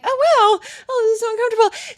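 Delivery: very high, quick, flustered voice; imitated younger self. Younger self with his knees next to hers, high flustered pretence. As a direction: hide unease behind a high dumb act. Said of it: "I would just pretend like I was dumb".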